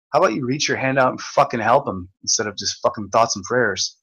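ordinary talk

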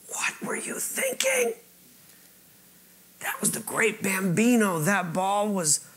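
breathy horrified whisper, slowed down; hushed kids. Retold or imitated. Newfound friends aghast at the lost ball, hushed whisper. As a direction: whisper it like the kids can't believe it. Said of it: "they're like".

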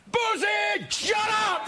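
high yelled shut up; mock shout. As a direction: shout it like shushing the party. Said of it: "party in the lounge where we can't hear you".

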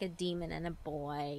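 ordinary delivery, nothing marked

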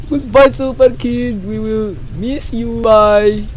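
slow, hammed-up goodbye; mock tearful farewell, slow and drawn out; mock sad farewell. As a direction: pretend to mourn him, slowly. Mock farewell to defeated foe.

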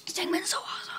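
quick breathy whisper aside. Real, not acted. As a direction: whisper it like a secret. Whispered aside about artist.

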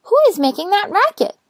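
high, loud and cross; annoyed little rosebud. Acted. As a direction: complain about the racket, high.

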